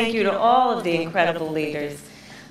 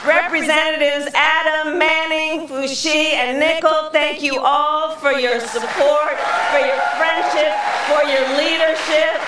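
raised, higher projecting rally voice; rally speech; 8 semitones higher, 6 dB louder than usual. Acted. Vice president thanking local leaders at rally, projecting speech. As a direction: address the crowd like a stump speech.

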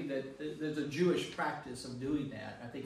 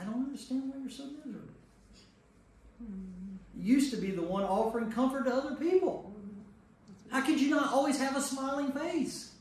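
higher, fast, a touch of mock puzzlement; mock friends' voice; 8 semitones higher than usual. Leader voicing Job's friends to the group, mild mock disbelief. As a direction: lightly play the clueless comforter.

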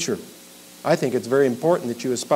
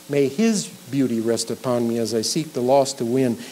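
normal talking voice

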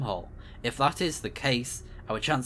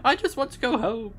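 whiny, much higher fake sob voice; hammed-up blubbering; a crybaby voice mocking the sad robot; mock crying; 12 semitones higher than usual. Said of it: "And he's like".

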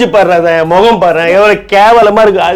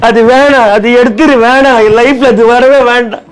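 higher, wailing sob of refusal; mock sobbing; 6 semitones higher than usual. Husband sobbing as his wife says she likes him, in a hammed-up wail.

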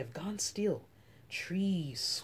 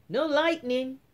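eager reply; 7 semitones higher, 6 dB louder than usual. Acted. Eager child answering mother.

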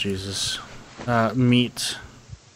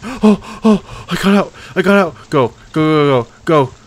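high, loud, thrilled shouts; excited relief; 5 semitones higher, 9 dB louder than usual. Real, not acted. Just escaped the trap, loud excited relief. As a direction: burst out in relieved joy.

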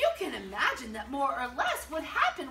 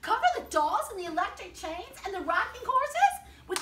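higher, louder dismayed protest; protesting elves; 7 semitones higher, 6 dB louder than usual. A narrator's reading. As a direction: rattle off the list in dismay. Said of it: "cried the gnomes and the elves".

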